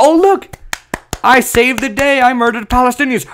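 high-pitched mock boast; Netanyahu. Mock leader boasting he saved the day, gleeful parody. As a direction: crow it like a self-declared hero.

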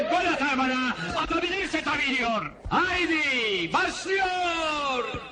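very high, carrying fairground cry; high-pitched street showman hawking a game; barker's call. Acted. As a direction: call in punters with drawn-out slogans. Barker calls customers to play.

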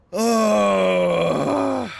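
long, high, loud wail; frustrated wail. Real, not acted. Player who cannot think, frustrated wail. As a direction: howl out the frustration.

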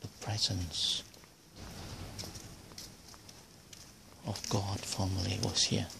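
hushed voice, barely voiced; whispering. Real, not acted. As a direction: speak hushed, like in church. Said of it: "I have to whisper because".

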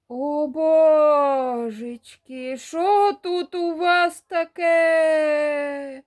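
put-on high, loud, slow drawn-out old woman's cry; a granny aghast at the bloody yard; shocked old woman. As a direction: gasp like a granny at the mess.

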